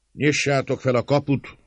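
high, loud demand; commanding shout. A narrator's reading. Attacker to servants at the gate, shouting an order. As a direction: call out the order at full voice.

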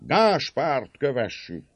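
high-pitched mob shouts; rallying cry from the crowd; crowd's roar. A narrator's reading. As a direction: urge everyone onward, calling out. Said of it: "zúgott a nép".

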